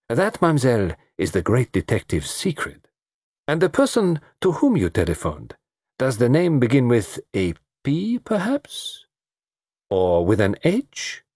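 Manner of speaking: teasing detective, pausing before each guess; courtly foreign detective. Acted. Detective guessing whom she telephoned, courteous probing. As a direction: probe politely, lifting the pitch on each guess.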